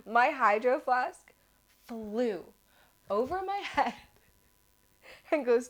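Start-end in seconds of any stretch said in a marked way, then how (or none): none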